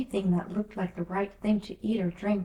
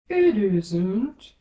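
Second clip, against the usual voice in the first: caterpillar voice; 9 dB louder than usual. A narrator's reading. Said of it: "said the caterpillar".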